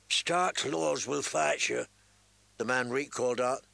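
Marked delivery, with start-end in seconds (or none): 0.1-1.9 a cringing servant with a sly edge; lowly servant voice. Acted. Reek calls out.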